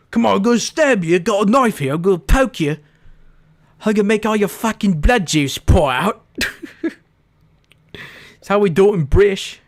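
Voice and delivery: high, loud mock British geezer threat; mock British accent. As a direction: ham up a British thug accent, teasing. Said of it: "my British voice sounds like Australian".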